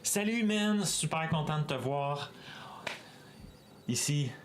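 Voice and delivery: quick, high, bright-voiced Quebecois greeting; Quebecois French. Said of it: "Redeem in Quebecois".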